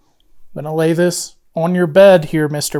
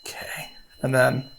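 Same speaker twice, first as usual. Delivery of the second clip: whispering; 4 semitones lower, 9 dB quieter than usual. Real, not acted. Quiet ghost hunt in house.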